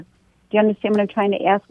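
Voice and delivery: ordinary delivery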